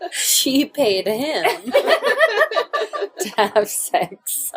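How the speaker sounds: laughing through the words; can barely get it out for laughing. Real, not acted.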